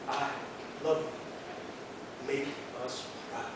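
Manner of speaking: hushed, low and tender; tender quote. Retold or imitated. Marine quoting his wife's six-word letter, tender and hushed. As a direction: let each short phrase drop soft and low.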